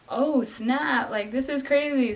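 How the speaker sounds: retold wide-eyed amazement; amazed reaction. Acting out her amazed reaction.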